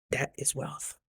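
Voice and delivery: quiet, understated punchline; hushed emphasis. Retold or imitated. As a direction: say it softly, letting it sink in. Repeats the line for emphasis.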